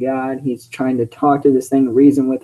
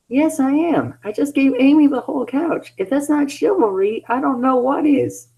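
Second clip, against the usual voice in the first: high, smug bragging voice; smug Sonic; 12 semitones higher than usual. Acted. Sonic bragging about his chivalry, high and smug. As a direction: boast proudly in a high voice.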